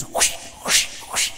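breathy unvoiced whoosh noises for the latches; latch whooshes. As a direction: mimic heavy latches sliding open. Imitating door latches opening.

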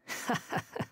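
short breathy chuckle; dry laugh. Acted. Told the world won't heed him, dry laugh.

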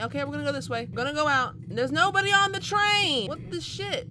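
ordinary talk, nothing marked

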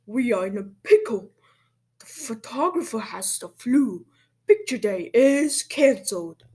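loud principal's announcement; loudspeaker announcer. A narrator's reading. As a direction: deliver bad news loudly to the whole school. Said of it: "a voice came from the loudspeaker".

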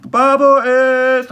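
high, loud, very slow, drawn-out Babo; mock stretched hook. Mimicking the hook's stretched end.

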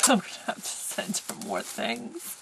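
breaking into a real laugh while talking; overwhelmed laughing. Real, not acted. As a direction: laugh through the words, touched by generosity. Overwhelmed by the generous gift.

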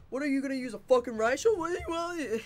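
high, hammed-up gamer taunt; mock taunting yell. Gamer mimicking old-days riot shield taunts, high and hammed-up. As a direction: squeal it in mock challenge.

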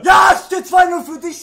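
loud, spluttering protest; aggrieved loser's shout; frustrated shouting. Real, not acted. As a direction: yell it at your opponent in exasperation. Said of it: "du spuckst und schreist so".

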